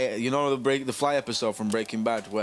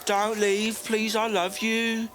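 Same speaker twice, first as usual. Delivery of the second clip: high, drawn-out Midlands begging; Midlands accent; 10 semitones higher, much slower than usual. Acted. Midlands lady begging Henry not to leave, pleading. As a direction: beg slowly in a hammed-up Midlands whine. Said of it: "that's my Midlands accent impression".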